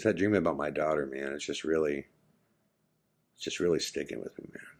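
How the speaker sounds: retold quiet confession, low and soft; subdued confiding. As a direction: imitate a troubled friend confiding softly. Troubled friend confides after falling quiet.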